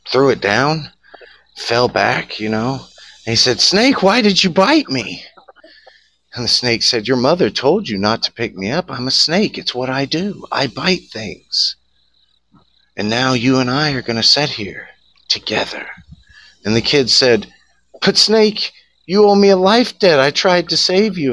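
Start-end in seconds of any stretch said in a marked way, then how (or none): 3.6-5.2 put-on high, loud young boy's cry; hurt child. Little boy asks the snake after being bitten, high hurt cry. 7.1-15.9 smug snake. Acted. Snake tells the bitten boy it was always his nature, sly and smug. 18.0-21.3 put-on high, loud pleading little boy; desperate child. Boy reminds the snake of its life debt, high desperate plea.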